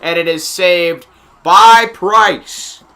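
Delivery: loud live hockey call; play-by-play. Real, not acted. Announcer telling viewers of a save, punchy play-by-play.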